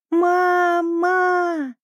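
put-on high, long-held childlike call; crying out. A narrator's reading. Little penguin cries for mother from drifting ice, crying out. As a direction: stretch the cry out across the water. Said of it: "закричал Пик так громко, как только мог".

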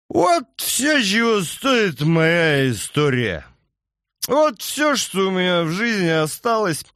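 put-on high, slow slurred drunk lament. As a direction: drag the words like a drunk. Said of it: "заплетающимся языком проговорил Яго".

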